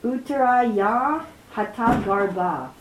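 slow, drawn-out Sanskrit recitation; verse recitation. Real, not acted. Teacher reads the Sanskrit verse to students, slow, loud recitation.

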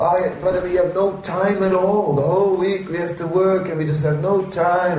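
high-pitched, put-upon excuse-making; mock complaining. Devotee excusing himself from temple Sunday, mock high complaint. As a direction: plead busyness in a hammed-up high voice.

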